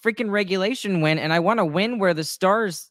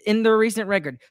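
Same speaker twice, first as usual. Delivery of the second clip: ordinary talk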